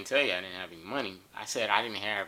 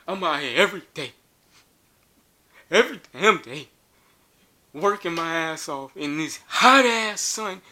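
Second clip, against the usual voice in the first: high, loud soapbox yelling; 10 semitones higher, 6 dB louder than usual. Retold or imitated. Homeless man yelling to the gathered crowd, loud and high. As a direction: preach his struggle to the whole crowd at full volume. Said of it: "So he started yelling".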